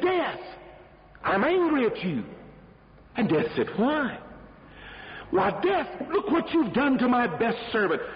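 a sheik scolding Death for frightening his servant; angry sheik. Acted. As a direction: confront Death over the servant. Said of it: "And the sheik was angry".

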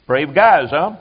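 ordinary talk, nothing marked

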